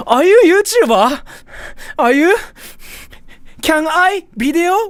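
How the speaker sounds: put-on very high, loud squeaky kid asking eagerly; Japanese kid voice. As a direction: chirp it like an excited schoolkid with broken English. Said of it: "the first phrase they come up with is like".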